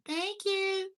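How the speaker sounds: put-on squeaky little kid asking for breakfast; high kid voice. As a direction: pitch way up like a small child. Kid thanking parent.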